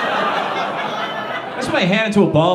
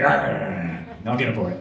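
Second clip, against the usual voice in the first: low creepy growl, a touch hammed-up; creepy babysitter; 7 semitones lower, 5 dB quieter than usual. Babysitter answering the kids, low mock-creepy growl. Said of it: "Not, not that creepy".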